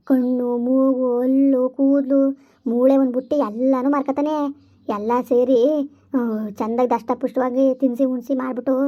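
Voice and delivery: low, eerie ghost warning of the trafficker. Acted. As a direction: speak low like a knowing ghost. Ghost voice again. (ತಗ್ಗಿದ ದೆವ್ವದ ಧ್ವನಿ)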